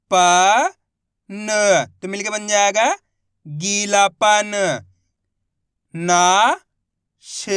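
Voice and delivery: put-on teacher spelling out syllables for young readers; syllable-by-syllable reading drill. Shown as a demonstration. Teacher sounding out Hindi words for students, syllable by syllable. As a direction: sound out each syllable loud, then blend the word slowly. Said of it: "एक ही अक्षर का उच्चारण करना है".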